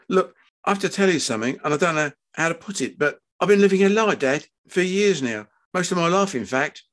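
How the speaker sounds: nervous son working up to the truth; anxious confessing son. Acted. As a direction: blurt it out to your dad.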